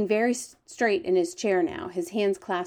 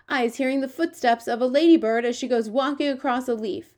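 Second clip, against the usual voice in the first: higher-pitched storybook giant with quaint grammar; lively, raised giant character voice; eager, boastful giant; 4 semitones higher, 5 dB louder than usual. Acted. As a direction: play the friendly giant, proud of his hearing. The giant's lines in a read story.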